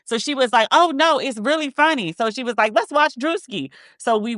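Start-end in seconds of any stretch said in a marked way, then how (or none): 0.7-2.1 lightly mimicked, higher-pitched insistence; imitated stylist. Stylist persuading client to watch, lightly imitated higher. 2.8-3.7 lightly mimicked, higher-pitched suggestion; imitated stylist. Stylist inviting client to watch a show, lightly imitated higher.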